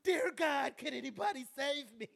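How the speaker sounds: high, loud cry for rescue; hammed-up plea to heaven; mock desperate prayer. Beaten man begs God for rescue, mock desperate. Acts out a fleeing man praying.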